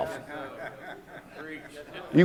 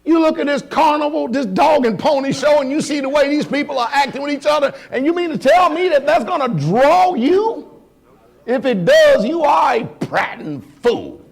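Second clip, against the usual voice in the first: higher, louder preaching that builds to a shout; fiery preaching; 8 semitones higher, 3 dB louder than usual. Real, not acted. Preacher calls out the congregation in fiery preaching.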